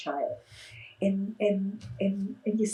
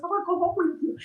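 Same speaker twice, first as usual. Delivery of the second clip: put-on high, sweet little boy plea; little kid voice; 10 semitones higher, faster than usual. Kid in the back seat asking the clown, light child voice. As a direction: ask like a small child who wants to tag along.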